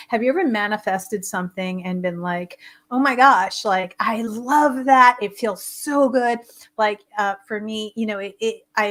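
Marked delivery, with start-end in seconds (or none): 2.9-6.4 delighted gush. Acted. Acting a manifesting delight.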